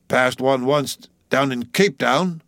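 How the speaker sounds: put-on slow, grudging old cook; sullen. A narrator's reading. Old man asked about church, slow sullen answer. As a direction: admit it sullenly, dragging the words. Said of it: "said the old man sullenly".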